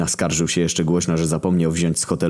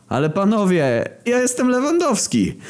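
high, indignant cartoon voice; scruffy footballer protesting to the park drunks, high. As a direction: insist on who you are. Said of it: "zaprotestował młodzian".

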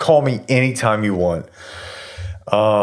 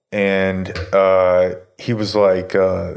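usual speaking voice

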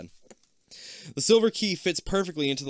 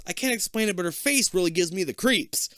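higher teasing mimicry of a friend; 7 semitones higher, 3 dB louder than usual. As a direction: tease her, echoing her words. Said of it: "Kip teases".

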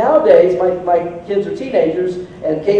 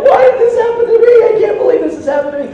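much higher whiny wail, then fast; mock despair; 13 semitones higher, 5 dB louder, faster than usual. Dad cleaning up the mess, mock self-pity. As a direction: wail it like a hard-done-by dad. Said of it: "and I'm like".

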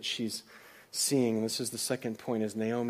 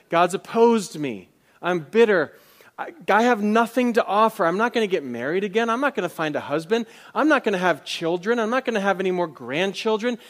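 bitter lament; 6 semitones higher, 8 dB louder than usual. Retold or imitated. Voicing bitter Naomi's lament.

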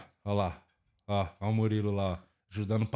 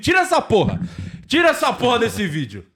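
angry shout; 6 semitones higher than usual. Real, not acted. Yelling to remove the video.

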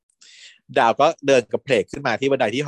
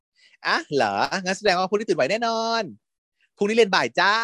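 teasing reply; 7 semitones higher than usual. Retold or imitated. Teasing reply in retold scene.

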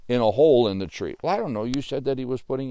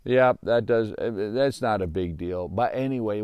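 ordinary talk

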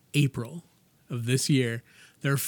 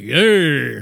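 mock boast; 13 dB louder than usual. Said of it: "were like".

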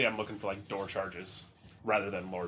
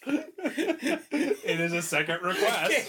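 laughing; 8 dB louder, much slower than usual. Real, not acted. Players erupt laughing at request.

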